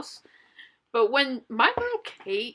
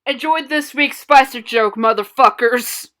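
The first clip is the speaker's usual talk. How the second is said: shouting; 9 dB louder than usual. Acted. Taunting shout.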